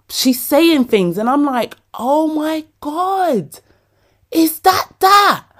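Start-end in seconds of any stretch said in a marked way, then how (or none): 1.9-5.5 high, slow, breathless wonder; high slow "is that that" in awe; stunned realisation. Retold or imitated. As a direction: gasp it out as it dawns on you. Tearing up in interview.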